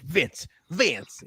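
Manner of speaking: lightly imitated Southern drawl; mock Southern accent. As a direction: call out like a super Southern wrestler. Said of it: "he's super Southern here".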